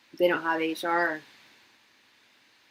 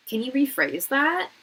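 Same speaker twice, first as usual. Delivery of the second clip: sassy comeback; 7 semitones higher, 6 dB louder than usual. Retold or imitated. Retorting to rude instructor.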